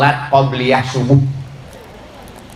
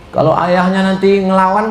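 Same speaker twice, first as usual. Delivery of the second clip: normal talking voice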